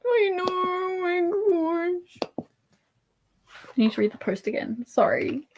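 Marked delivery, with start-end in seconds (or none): 0.0-2.5 high, drawn-out tearful squeak; real overwhelmed, high-pitched coo; close to crying. Real, not acted. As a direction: squeeze it out slowly, on the verge of tears. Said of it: "I might actually start crying".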